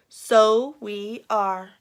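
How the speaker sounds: very slow, drawn-out repeat; knowing slow echo. Acted. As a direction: stretch each word, knowingly. Mom slowly echoes.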